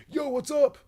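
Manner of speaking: very high, loud dude greeting; goofy selfie greeting. Retold or imitated. Fan posing for a celebrity photo, hammed-up. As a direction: bro it up for the photo. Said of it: "me being like".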